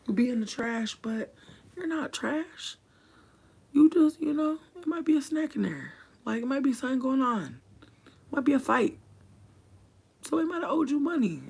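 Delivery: way up high, a comic sermon on trash; squeaky high voice. Pretend. Telling viewers life's lesson about trash, squeaky high voice. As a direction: lift the whole thing into a squeaky cartoon pitch.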